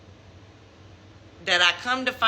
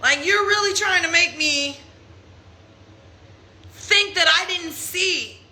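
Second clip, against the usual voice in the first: pitched-up disbelief at his lies; higher, exasperated retold confrontation; retold outrage; 7 semitones higher than usual. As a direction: tell him he's crazy, high and incredulous. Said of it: "And I was like".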